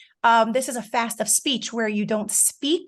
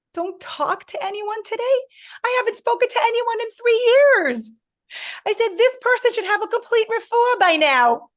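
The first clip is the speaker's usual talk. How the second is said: upset outburst; 11 semitones higher, 4 dB louder than usual. Retold or imitated. Retelling her tearful outburst.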